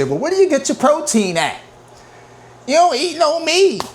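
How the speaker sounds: very high squeaky mocking voice; mock doubters. Vlogger mimicking people who question his protein, high mocking voice. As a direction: imitate naysayers in a cartoonish high pitch. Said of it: "They say".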